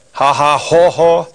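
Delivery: hammed-up braying haw-haw laugh; hysterical laugh. Shown as a demonstration. Teacher hamming up the hysterical cartoon face, mock big laugh.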